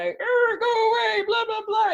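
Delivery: high, loud shooing yell; frantic baker yelling at stampeding animals, hammed-up shout; mock shout. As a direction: shoo the pig away, hammed up. Chasing off an escaped pig.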